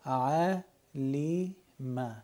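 ع لی م read syllable by syllable. Shown as a demonstration. Teacher spelling out a Quran word by syllables. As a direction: sound out ع, لی, م separately. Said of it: "حرف میم باز حرکت فتحه داره م".

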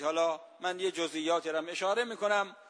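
normal talking voice

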